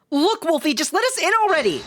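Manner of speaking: high, insistent character voice. Acted. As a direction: pitch it right up and push impatiently. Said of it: "that's just higher pitch, right?".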